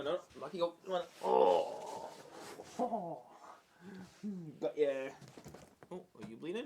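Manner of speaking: usual speaking voice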